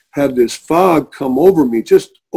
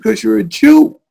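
higher and louder, put-on voice insisting; emphatic objection; 7 semitones higher, 5 dB louder than usual. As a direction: state it as the obvious reason.

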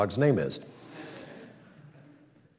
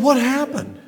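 surprised; 12 semitones higher than usual. Retold or imitated. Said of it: "I said".

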